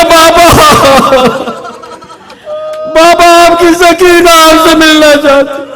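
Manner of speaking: high, anguished call to her father; a daughter's broken cry for her father. Retold or imitated. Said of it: "تڑپ کے کہا".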